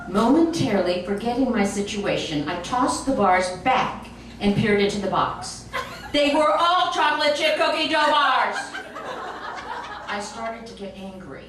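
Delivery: ordinary delivery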